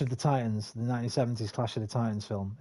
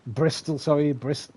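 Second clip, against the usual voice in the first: normal talking voice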